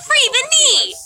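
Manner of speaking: high, drawn-out mock protest; hammed-up high-pitched schoolgirl wail; mock complaint. Schoolgirls protesting their too-long skirts, in a hammed-up complaint. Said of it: "girls at that school complained a lot".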